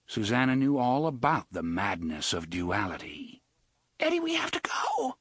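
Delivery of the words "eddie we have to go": high, urgent frightened whisper; terrified whisper. A narrator's reading. Susanna begging Eddie to flee, terrified whisper. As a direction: hiss it out in panic. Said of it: "she said".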